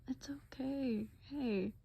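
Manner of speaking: put-on soft, hushed, caring boyfriend at the dentist; tender boyfriend Eddie. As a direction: soothe her gently, half whispering. Boyfriend comforting girlfriend.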